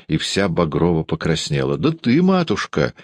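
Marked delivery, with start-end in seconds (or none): none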